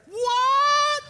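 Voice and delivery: squeaky high, loud startled what; shocked what. Retold or imitated. Husband yelps back at wife's accusation, stunned. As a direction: yelp it in disbelief.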